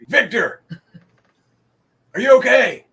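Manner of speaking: loud, high urgent shout; alarmed call. Acted. Captain checking on Victor, alarmed loud call. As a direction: call out to a fallen comrade. GM voices captain calling to comrade.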